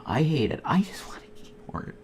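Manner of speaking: whispered complaint; frustrated whisper. Real, not acted. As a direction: hiss it softly under your breath. Frustrated aside while searching.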